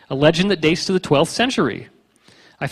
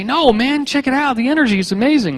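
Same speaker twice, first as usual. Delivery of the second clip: excited stoner local; 8 semitones higher than usual. Retold or imitated. Locals defending crop circle energy.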